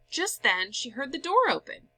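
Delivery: ordinary delivery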